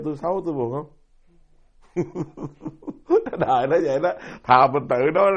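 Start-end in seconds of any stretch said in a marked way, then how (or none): 1.9-3.3 a quick high laugh that breaks out mid-sermon; short laugh. Real, not acted. Monk laughing to his listeners, short and high. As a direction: laugh at your own joke about pride.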